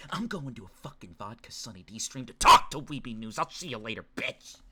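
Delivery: low-voiced swaggering goodbye; acted tough farewell. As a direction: drop low and play it tough.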